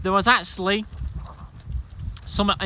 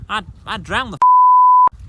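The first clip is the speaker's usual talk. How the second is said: angry outburst; 12 dB louder, slower than usual. Real, not acted. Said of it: "I'll have to beep that bit out".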